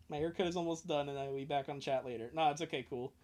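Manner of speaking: usual speaking voice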